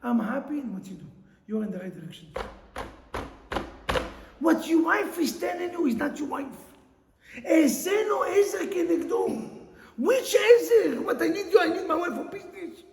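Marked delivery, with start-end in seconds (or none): 4.4-12.8 rising, emphatic preacher's voice; fiery preaching. Acted. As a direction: preach it to the rafters. Rabbi preaching at full force.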